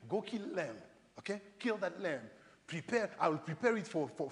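ordinary talk, nothing marked

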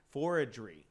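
"Forgery" retried, sounded out with care; correcting a stumbled word. Real, not acted. Host retrying "Forgery" after tripping on it, careful correction. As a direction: have another careful go at "Forgery". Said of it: "that took me three times".

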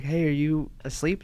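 low, soft, tentative question; hushed checking. Retold or imitated. Asking a fiancee at four in the morning, hushed. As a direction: quietly check on a sleeper, retold.

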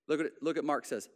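ordinary delivery

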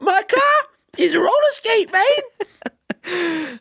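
very high squeaky Aussie; mock Australian accent. Co-host joining the impression of the listener, squeaky mock Australian.

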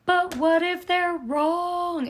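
imitating her own panicked doubt to her mom; retold anxious wail. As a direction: whine the worry out slowly. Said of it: "such a negative Nancy".

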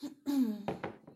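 one firm throat clear. Real, not acted. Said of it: "excuse me".

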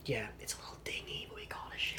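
whispered aside; a hushed whisper. Real, not acted. Mock hushed aside about the studio.

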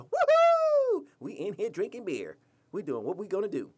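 loud high whoop, then rowdy bragging; hammed-up party cheer, then brag; mock partying. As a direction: whoop like a drunk at a packed bar. Mocking bar partygoers.

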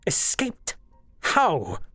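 high, startled demand for answers; incredulous demand. A narrator's reading. Koga hearing of the escape, incredulous demand. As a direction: leap up in pitch, demanding. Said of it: "Koga demanded".

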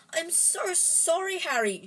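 high distressed apology, drawn out; wailing. A narrator's reading. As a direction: plead tearfully, pitch climbing. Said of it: "she wailed".

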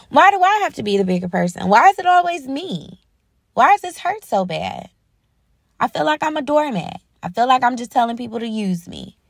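high, aggrieved lament; hurt protest. Acted. Voicing someone who feels justified in not forgiving, hurt protest. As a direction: plead against the unfairness, pitch lifted.

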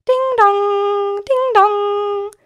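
sung high and loud like a bell; doorbell chime. A narrator's reading. Narrator voicing the doorbell that wakes Herr S, high chime. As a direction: ring it out like a doorbell.

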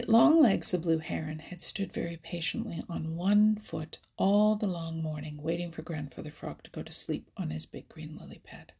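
usual speaking voice

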